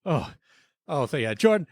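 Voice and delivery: usual speaking voice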